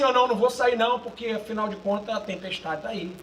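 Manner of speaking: low, fading grumble of excuses; mock older brother. Preacher mocking the older brother refusing to go out, low soft grumble. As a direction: mutter the excuse half-heartedly. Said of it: "é craque em falar assim".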